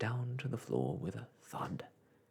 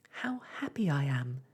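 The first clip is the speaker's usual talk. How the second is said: Jane's happy cry; 3 semitones higher, 4 dB louder than usual. A narrator's reading. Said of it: "cried Jane".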